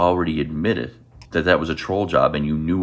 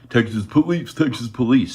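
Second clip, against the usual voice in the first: mocking repetition; 7 semitones higher than usual. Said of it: "you made yourself look like an idiot".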